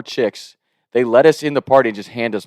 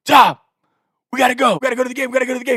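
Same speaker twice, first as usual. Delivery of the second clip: high, urgent call to a sleeping friend; urgent wake-up; 12 semitones higher, much faster than usual. Retold or imitated. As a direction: shake him awake, panicked. Cousin waking him urgently.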